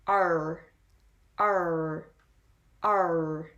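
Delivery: strong American R in "R"; rhotic R. Shown as a demonstration. Teacher modelling a word for learners, "R" with strong rhotic R. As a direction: hold the final R sound in "R", drawn out. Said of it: "rhotic R sound".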